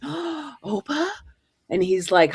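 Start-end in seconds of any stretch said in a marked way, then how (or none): 0.0-1.1 high, breathless gasp of a name; astonished recognition. Retold or imitated. Granddaughter recognizing her grandfather in a dream, stunned. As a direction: catch your breath and call out in disbelief.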